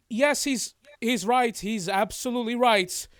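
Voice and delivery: high, loud eager elf voice; elf agreeing. Acted. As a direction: call out in firm agreement. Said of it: "you hear".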